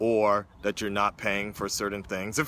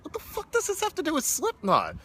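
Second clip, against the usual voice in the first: mock bafflement. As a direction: retell your confusion in a very high voice. Retelling his baffled reaction to video.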